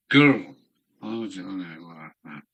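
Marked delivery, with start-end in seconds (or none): 0.1-0.5 loud. Shown as a demonstration. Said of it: "repeating a word loudly".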